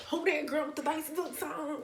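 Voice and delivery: high, lightly voiced admiring onlooker; mock admiring voice. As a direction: toss off a teasing compliment. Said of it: "I went from".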